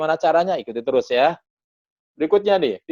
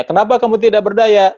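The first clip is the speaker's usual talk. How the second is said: pointed question; 8 semitones higher, 9 dB louder than usual. Retold or imitated. Asking why helpless.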